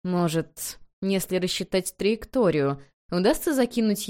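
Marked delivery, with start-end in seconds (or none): none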